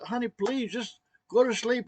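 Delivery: high, coaxing plea; retold pleading. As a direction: beg her to settle down. Begging wife to sleep.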